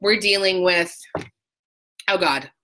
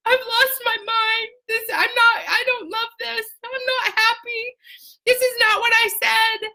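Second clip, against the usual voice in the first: much higher, frantic self-talk; panicked inner voice; 14 semitones higher than usual. Retold or imitated. Washing a glass while sobbing, high panicked voice. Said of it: "I'm washing that glass going".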